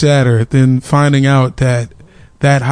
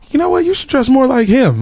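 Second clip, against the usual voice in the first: mom voice; 8 semitones higher than usual. Retold or imitated. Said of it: "she was like".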